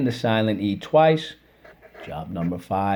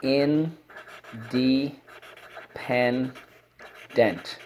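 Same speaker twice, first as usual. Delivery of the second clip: very slow stretched word; 3 semitones higher, 5 dB quieter, much slower than usual. Shown as a demonstration. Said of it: "Let's go".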